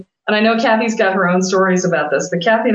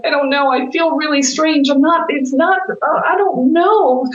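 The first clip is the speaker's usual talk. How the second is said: high-pitched, faltering bewilderment; confused friend; 7 semitones higher than usual. Retold or imitated. Woman telling her healer she feels strange, high confused voice. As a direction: imitate someone disoriented, voice lifted and halting.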